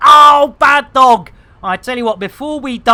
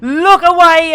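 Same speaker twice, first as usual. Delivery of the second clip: loud, higher warning call; mock alarm; 6 semitones higher, 6 dB louder than usual. Real, not acted. Streamer warning chat to look away, loud high call. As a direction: call out to everyone to avert eyes. Covering an embarrassing sight.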